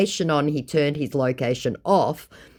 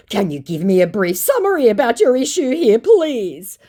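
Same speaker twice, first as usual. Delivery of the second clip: mock scammer voice; 9 semitones higher, 7 dB louder than usual. Voicing the desperate scammer.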